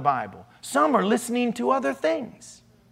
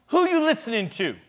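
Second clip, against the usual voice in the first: higher, challenging dialect question; mimicked sassy attitude; 6 semitones higher than usual. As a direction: put on the kid's attitude, pitched up. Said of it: "that's where this attitude is coming from".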